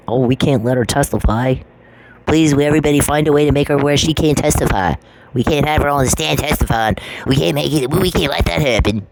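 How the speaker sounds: put-on, pleading mockery; mocking plea. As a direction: mimic opponents pleading in exaggerated alarm. Mocking opponents' panicked plea in court.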